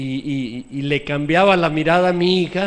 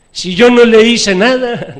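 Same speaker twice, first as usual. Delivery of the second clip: loud, slow, higher mock-innocent protest; cruel denial; 7 semitones higher, 13 dB louder than usual. Father mocking his own cruel excuse to the congregation, loud. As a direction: play the cruel one pretending innocence. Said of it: "la expresión del cruel".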